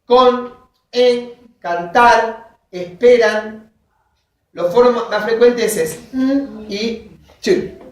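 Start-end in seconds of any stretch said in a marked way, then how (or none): 0.0-3.7 slow example words. Shown as a demonstration.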